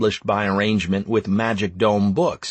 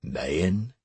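grave new emperor; 5 semitones lower, 6 dB quieter than usual. Acted. New emperor gravely addresses the hero.

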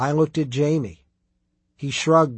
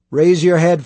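loud, firm order from the prioress; commanding; 3 semitones higher, 8 dB louder than usual. A narrator's reading. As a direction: order her to look up, loudly. Prioress orders a shy underling.

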